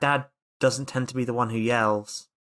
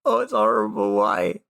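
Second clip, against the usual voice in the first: hammed-up disgust; 8 semitones higher than usual. Acting sick over garbage stench.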